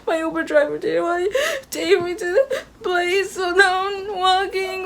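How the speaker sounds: high, teary, sobbing words, slowing as they break; crying on the phone. Retold or imitated. Telling her boyfriend on the phone, crying. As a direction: let the voice crack and sob through it. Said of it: "like, crying".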